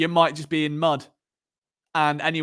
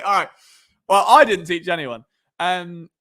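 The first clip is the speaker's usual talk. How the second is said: ordinary talk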